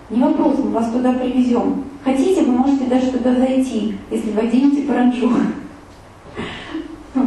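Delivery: ordinary talk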